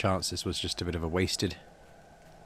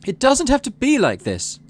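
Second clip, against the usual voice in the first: much higher and louder, impassioned; crying out; 9 dB louder than usual. Acted. Mars rushes the stage and cries out to the crowd. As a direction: plead urgently with the crowd. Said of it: "she cries".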